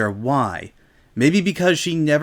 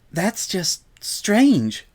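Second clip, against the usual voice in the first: very slow, timid pony voice; Fluttershy voice; 4 semitones higher, much slower than usual. Acted. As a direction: draw it out hesitantly and slowly.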